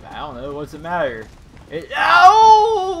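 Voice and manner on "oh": high, very loud drawn-out howl; long startled yell. Real, not acted. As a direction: yell out as if falling. Nearly falls off in game.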